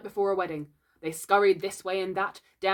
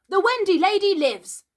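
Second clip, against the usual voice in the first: high, loud exclamation; thrilled high-pitched shout; excited cry; 13 semitones higher, 10 dB louder than usual. A narrator's reading. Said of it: "Slightly cried instantly".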